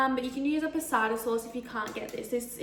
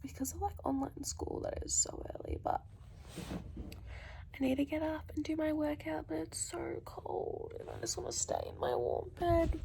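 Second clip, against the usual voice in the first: hushed whisper so no one wakes; breathy whisper under the covers; early-morning whisper; 8 dB quieter than usual. Real, not acted.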